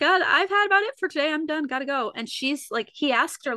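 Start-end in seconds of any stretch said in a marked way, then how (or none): 0.0-2.1 mock casual brush-off. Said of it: "ended the session so casually".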